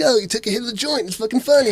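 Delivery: mock the parents cheering the toddler on; imitating amused stoner parents. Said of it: "and, like".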